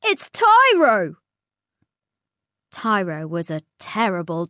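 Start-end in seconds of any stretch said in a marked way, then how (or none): 0.0-1.2 high, loud and slow alarm; panicked warning. Acted. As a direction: warn everyone the monster is coming. Stegs warns of Tyro.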